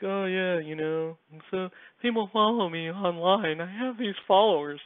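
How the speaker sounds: mocking high-pitched put-on voice; mock weirdo. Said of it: "some people get, like, weird about it".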